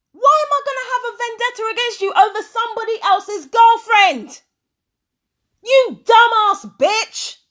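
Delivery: furious rant. Real, not acted. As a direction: yell it in sheer disbelief. Furious rebuttal to played accusation.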